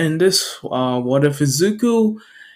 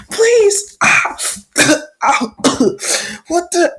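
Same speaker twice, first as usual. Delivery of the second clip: high, coughing pleas; acted pained begging; slower than usual. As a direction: plead between coughs and yelps. Small child being kicked begs.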